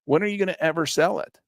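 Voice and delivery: ordinary talk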